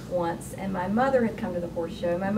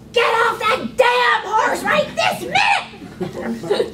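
a loud, high, slow scream; 14 semitones higher, 8 dB louder, much slower than usual. Retold or imitated. Mother screaming at her daughter on the horse, a loud slow scream. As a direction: scream it at the top of your lungs. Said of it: "she screams".

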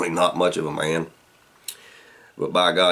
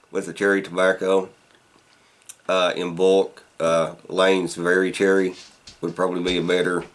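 talking around pipe stem; pipe in mouth. Real, not acted. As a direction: teeth clenched on pipe. Seen 0: Pipe stem held in mouth from +0.8 through +8.8 while talking.

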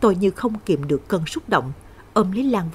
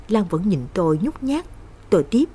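ordinary delivery, nothing marked

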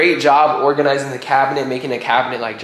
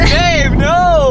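shocked protest; 13 semitones higher, 5 dB louder than usual. Real, not acted. Objecting to leaving dog.

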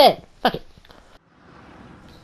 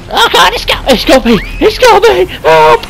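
high, loud frantic yelling; panicked cries for help; 7 semitones higher, 6 dB louder than usual. Real, not acted. Player caught by creature screams to friend, frantic yelling. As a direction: shriek for help as it drags you. Tentacle creature grabs him.

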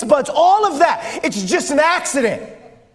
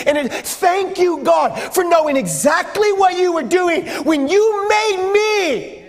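higher, building heartfelt thanksgiving; fervent prayer of thanks; 4 semitones higher than usual. Acted. Preacher models thanking God for everyday gifts, fervent. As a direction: swell to a ringing, drawn-out finish. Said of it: "slow down enough to go".

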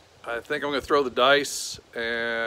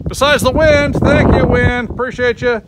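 loud sarcastic thanks over wind; sarcastic shout; 11 semitones higher, 13 dB louder than usual. Real, not acted. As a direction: yell a dry thank you at the wind.

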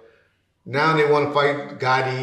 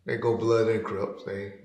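normal talking voice

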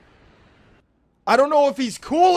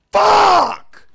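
loud, high outburst; angry shout; 9 semitones higher, 7 dB louder than usual. Real, not acted. Player cursing at the game, angry shout. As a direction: yell the curse long and high.

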